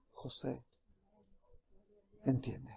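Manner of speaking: God's soft whispered call. Acted. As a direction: whisper it tenderly like a gentle call. God speaking softly to him.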